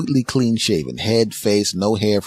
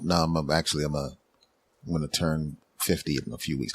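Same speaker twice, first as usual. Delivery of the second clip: soft, low reply; 4 semitones lower, 8 dB quieter than usual. Retold or imitated. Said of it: "And I go".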